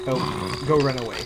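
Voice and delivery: ordinary talk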